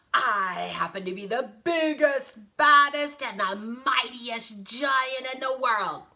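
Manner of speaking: loud, boastful giant; booming giant's boast; yelling giant. Acted. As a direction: brag big, punching each superlative. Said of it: "he yelled".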